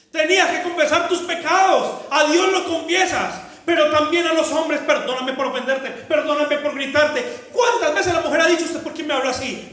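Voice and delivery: loud, high impassioned sermon voice; full-force preaching. Acted. Pastor rebuking the congregation, loud impassioned preaching. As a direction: drive it home with fire.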